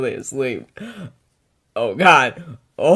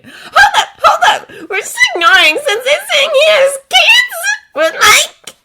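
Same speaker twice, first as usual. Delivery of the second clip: laughing shout; 10 dB louder than usual. Acted. Laughing outburst in chat.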